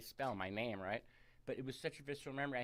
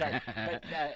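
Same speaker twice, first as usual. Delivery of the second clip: laughing; 4 semitones higher, 10 dB louder than usual. Real, not acted. Apologizing while laughing.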